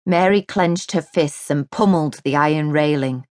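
usual speaking voice

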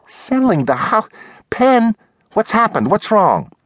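very high, shocked woman's voice; startled, urgent female character questions; alarmed assistant. A narrator's reading. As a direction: react in high-pitched disbelief and concern. Assistant alarmed at boss's news.

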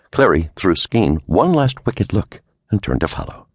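ordinary delivery, nothing marked

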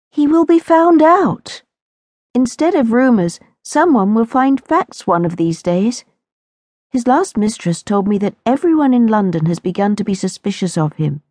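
a sheltered girl voicing her dread, pitched high; anxious young Lucinda. A narrator's reading. Lucinda tells her imaginary friend her fears, high and anxious.

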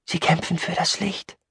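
soft, high character voice; Fehl. Acted. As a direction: offer it quietly, as if explaining. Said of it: "sagte Fehl". (leise und hoch, wie eine Erklärung)

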